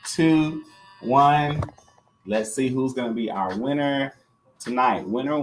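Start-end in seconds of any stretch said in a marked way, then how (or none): 0.0-1.6 louder, higher countdown call; game host counting down. Real, not acted. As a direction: punch out each number for the big reveal. Counting down to the draw.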